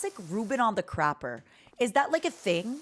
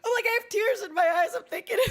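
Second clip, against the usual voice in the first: very high, hammed-up weepy apology; mock tearful whine; 11 semitones higher than usual. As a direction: whine as if on the verge of tears, playfully exaggerated. Acts out own tearful apology.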